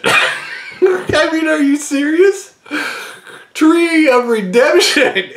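can't believe it, giggling; laughing disbelief. Real, not acted. Laughing at absurd card pick.

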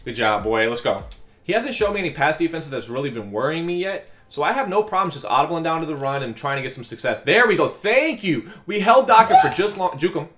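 ordinary delivery

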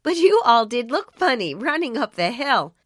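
teasing. A narrator's reading. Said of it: "he giggled".

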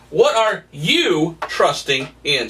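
"What are you trusting in?" slowly, weight on "What"; slow, pointed question. Shown as a demonstration. Preacher asks the congregation personally, slowly and pointedly. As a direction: ask it slowly, straight at each listener. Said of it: "I want this to be about you".

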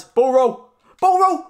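rising, loud building yell; excited shout. Real, not acted. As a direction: build up as it rolls in. Watching ball roll in.

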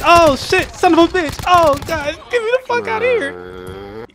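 high, loud frantic outburst; panicked yelling. Real, not acted. Gamer trying to escape danger, panicked yelling. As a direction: yell in a panic, pitch climbing at the end.

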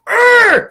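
loud high teasing roar of rage; ragey teasing growl; mock angry roar. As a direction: roar like a cartoon hothead to mock her. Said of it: "John used to tease me".

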